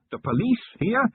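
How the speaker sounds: a bargeman mocking the claim; demanding stranger. A narrator's reading. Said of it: "A vague silhouette demanded".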